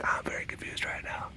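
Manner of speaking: whispered inner voice of the cat; soft whisper speaking for a confused cat. Retold or imitated. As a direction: voice the cat's thoughts in a whisper. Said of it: "He's like".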